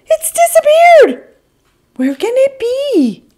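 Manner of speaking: very high, loud alarmed voice; panicked robot. Acted. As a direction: squeal in panic. Robot discovers bottom missing.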